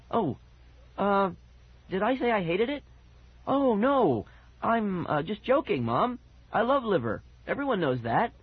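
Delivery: high, hesitant backpedaling; stuttering. A narrator's reading. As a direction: stammer nervously while covering up. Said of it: "began to stutter".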